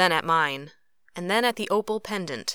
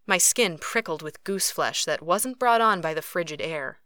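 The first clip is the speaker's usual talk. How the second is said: ordinary delivery, nothing marked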